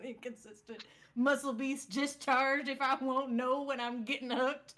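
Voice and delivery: slow, drawn-out Southern accent. Shown as a demonstration. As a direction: read it in a playful, stretched Southern drawl. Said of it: "reading some of this with a Southern accent".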